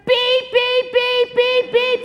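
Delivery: loud, high alarm beeping; warning beeps. Acted. Imitates a warning signal alarm.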